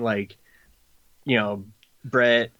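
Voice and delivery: ordinary talk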